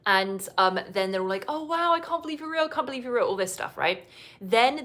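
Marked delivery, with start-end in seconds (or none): 1.5-3.3 higher, quick mock amazement; mock gushing. Couple marvelling at each other in person, mock gushing. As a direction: imitate the couple gushing on meeting. Retold gushing first meeting.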